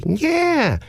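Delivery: high, sing-song creepy caller voice; odd prank caller. Retold or imitated. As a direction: play the weird caller way up high. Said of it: "And he said".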